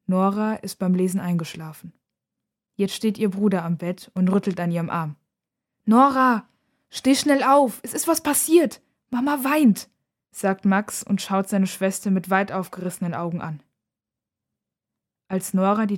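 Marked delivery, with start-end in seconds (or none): 5.8-9.9 put-on high, urgent child's voice; anxious, higher-pitched pleading; alarmed. A narrator's reading. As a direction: rouse your sleeping sister with bad news. Frightened brother wakes his sister.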